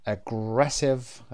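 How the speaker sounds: drawn-out "aggressive" with GRESS stressed; stress on second syllable. Shown as a demonstration. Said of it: "قسمت دوم".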